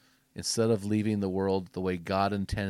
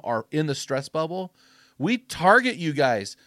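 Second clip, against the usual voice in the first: louder, higher, rousing sermon voice; fired-up preaching; 6 semitones higher, 7 dB louder than usual. Acted. Podcast host rallying men to finish strong, fired-up preaching.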